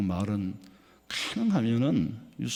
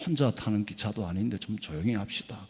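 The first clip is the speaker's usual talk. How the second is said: polite hush request; 6 semitones lower, 5 dB quieter than usual. Retold or imitated. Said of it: "이 사람이 그랬대".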